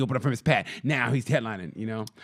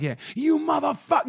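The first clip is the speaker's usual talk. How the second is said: mock angry outburst, pitched up high; 12 semitones higher than usual. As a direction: act an over-the-top angry confrontation. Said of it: "you can't come off like".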